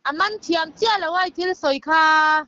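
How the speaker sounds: ordinary talk, nothing marked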